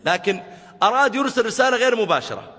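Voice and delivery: high, loud emphatic preaching; loud, lifted sermon emphasis. Real, not acted. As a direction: drive the point home to the crowd.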